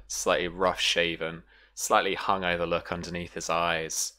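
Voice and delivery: normal talking voice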